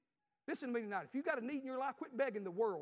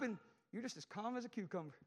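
usual speaking voice

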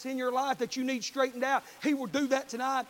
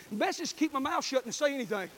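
ordinary talk, nothing marked

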